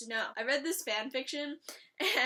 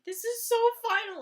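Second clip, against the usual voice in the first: much higher and louder, distraught; real distress at the funeral pyre; anguished wail; 10 semitones higher, 9 dB louder than usual. Real, not acted. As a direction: cry it out in despair. Her anguish at the body burning.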